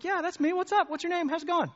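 high, rapid-fire casual greeting; chirpy retired teacher. Retold or imitated. Retired teacher answering a stranger online, breezily high-pitched. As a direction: rattle off friendly chat replies.

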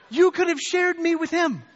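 high, loud mock reproach; accusing inner voice. Conscience scolding him over the missed witness, loudly reproachful. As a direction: cry out like a nagging conscience. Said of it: "this little voice inside me saying".

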